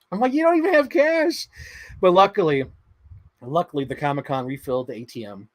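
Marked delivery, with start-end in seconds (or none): 0.3-1.5 high, louder exasperated brother; high, pointing out the obvious; exasperated retort. Retold or imitated. Retorting to sister.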